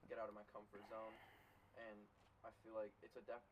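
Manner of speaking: ordinary talk, nothing marked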